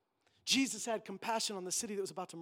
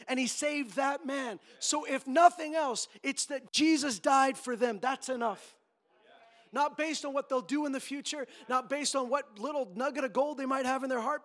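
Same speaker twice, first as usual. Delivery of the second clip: loud, high, impassioned preaching; full-force preaching; 9 semitones higher, 11 dB louder than usual. Acted. Pastor urging the church to love the lost, full-force preaching. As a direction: drive the point home to the congregation.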